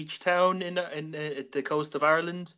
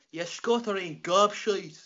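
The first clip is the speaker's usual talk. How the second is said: higher, slow, exaggerated Irish brogue; weird Irish accent; 5 semitones higher, slower than usual. Shown as a demonstration. Irishman showing how the insult must be said, weird Irish accent. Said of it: "you got to say that one with a weird".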